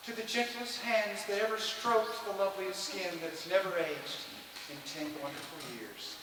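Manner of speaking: soft, put-on wifely voice; wife's toast. Husband mimicking wife's loving toast in soft put-on voice. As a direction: imitate the wife softly, sinking at the end. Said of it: "She toasts".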